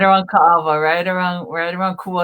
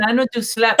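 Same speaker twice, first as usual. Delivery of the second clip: ordinary talk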